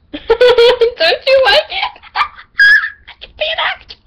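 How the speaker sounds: high, loud, laughing squeal; giddy shriek. Real, not acted. Reader jokes about their own acting, giddy squealing. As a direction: shriek it out through giggles. Joking burst after the acted lines.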